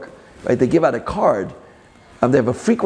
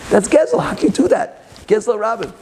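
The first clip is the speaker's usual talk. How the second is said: upset outburst; 8 semitones higher than usual. Retold or imitated. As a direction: cry out at the thief in disbelief. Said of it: "And I really got upset".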